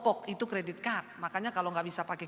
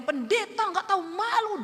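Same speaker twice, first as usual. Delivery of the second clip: high, loud insult; furious yell; 10 semitones higher, 4 dB louder than usual. Retold or imitated. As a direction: hurl the insult at the pastor. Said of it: "dia ngamuk".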